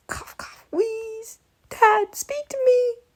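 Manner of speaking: high, slow, faked dying plea; hammy dying son. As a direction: overplay the grief like a melodrama. Mock death scene.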